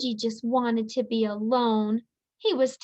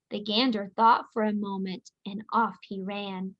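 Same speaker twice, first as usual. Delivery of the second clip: usual speaking voice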